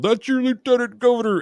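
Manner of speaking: high, yelled retort; mock yell. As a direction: shout it up high at the cops. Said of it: "he's the one that yelled".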